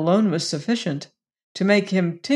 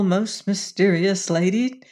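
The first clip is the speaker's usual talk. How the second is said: ordinary delivery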